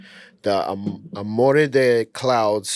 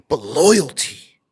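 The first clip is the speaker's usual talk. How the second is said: whispered. Acted. Dramatic slogan line for the camera.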